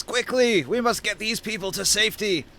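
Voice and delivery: high-pitched urgent command; rally someone to the rescue; urgent scion. Acted. Scion ordering the half-giant to save villagers, urgent voice. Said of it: "And the scion says".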